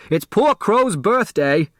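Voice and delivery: high, pitying exclamation; excited cry. A narrator's reading. Otis continues crying out.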